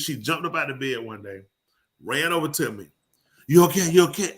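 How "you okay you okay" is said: high, loud worried check; imitated alarm. Retelling her checking on a man short of breath, imitated alarm. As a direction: fire off an urgent mock-concerned question.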